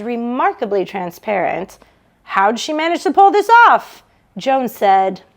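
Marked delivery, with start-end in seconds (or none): 2.2-4.0 higher, louder, barely hidden disbelief; Doctor Siskin. Acted. Colleague at her going-away party, transparently condescending. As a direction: let the condescension show through.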